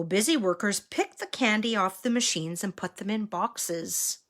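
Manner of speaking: plain reading voice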